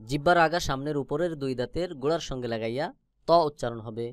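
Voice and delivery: ordinary delivery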